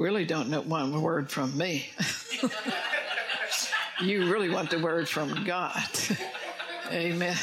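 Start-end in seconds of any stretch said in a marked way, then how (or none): 1.9-4.0 airy amused chuckle; breathy laugh. Real, not acted. Laughs at own joke. 5.9-6.9 quick, high, breathless giggle; airy giggle. Real, not acted. Laughs again.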